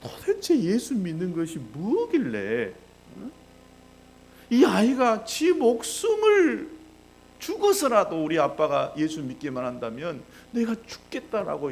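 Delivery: loud, high, anguished lament of a father; acted wailing. Father reading his dead child's diary, anguished wailing. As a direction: cry out in disbelief, stretching the words. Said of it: "통곡하면서 웁니다".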